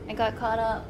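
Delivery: ordinary delivery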